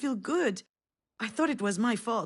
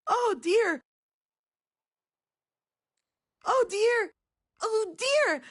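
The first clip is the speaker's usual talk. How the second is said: put-on much higher, louder repeated child's cry; child's panicked scream; 13 semitones higher, 5 dB louder, slower than usual. Mother imitating her daughter screaming at the lookout, high and loud. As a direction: wail it like a frightened little girl, up high.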